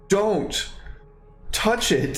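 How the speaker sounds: a hint of a stern dragon; scolding dragon. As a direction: scold like a disapproving elder. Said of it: "very scolding kind of look".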